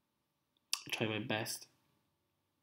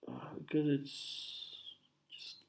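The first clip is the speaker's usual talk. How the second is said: whisper; 13 dB quieter than usual. Real, not acted. Sick and tired voice.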